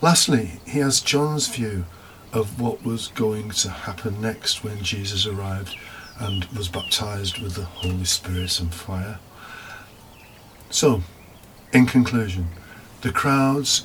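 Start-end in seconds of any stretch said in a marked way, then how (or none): none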